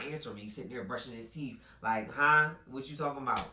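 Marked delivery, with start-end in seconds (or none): 2.1-3.5 loud, high "huh?"; feigned confusion; playing dumb. Boyfriend dodging questions.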